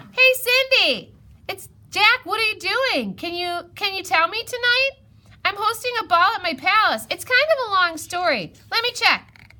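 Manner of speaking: high, bright-voiced princess calling out from afar; Cinderella. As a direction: sing out her lines like a breezy princess. Cinderella answers from her castle.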